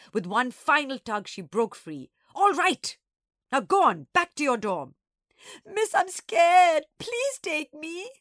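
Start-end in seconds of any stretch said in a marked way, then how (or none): 2.3-4.9 loud, high-starting stern order; exasperated command. A narrator's reading. Adult orders wailing child away. 5.6-8.1 very high, drawn-out pleading; frightened wail. A narrator's reading. Wailing child pleads, feigning fear.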